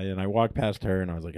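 normal talking voice

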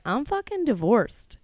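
unashamed, punchy proclamation; proudly defiant. Acted. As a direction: announce it boldly, stretching the words. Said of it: "And I'm like".

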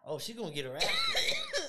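laughing through the words; giggling while saying it. Real, not acted. Laughing at the dog.

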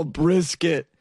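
fake-cry the word brisket; mock crying. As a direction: say brisket with a hammed-up sob. Mock sob over brisket.

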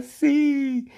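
high, stretched mock shout; grito imitado. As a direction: shout it back high, as the cat Floyd. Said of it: "Y Floyd grita". (grito agudo imitado)

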